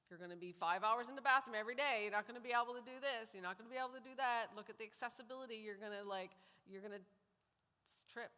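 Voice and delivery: rapid, worried rattling off of fears; panicky inner voice. Retold or imitated. Woman voicing her own panic at arrival, fast and anxious. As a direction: rush it like a spiralling mind.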